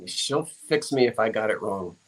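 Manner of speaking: normal talking voice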